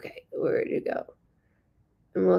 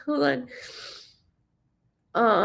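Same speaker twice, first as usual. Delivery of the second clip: ordinary talk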